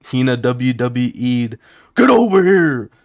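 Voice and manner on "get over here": high, loud battle cry; a high shouted catchphrase as she yanks him; game catchphrase shout. Retold or imitated. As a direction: bellow it like a fighting-game move. Hina yanks him, fighting-game quote.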